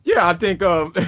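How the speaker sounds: talking through real laughter; can barely get the words out for laughing; words breaking up in laughter; laughing hard. Real, not acted. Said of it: "you laugh kinda hard on that one".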